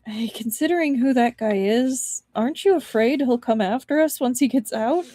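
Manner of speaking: ordinary delivery, nothing marked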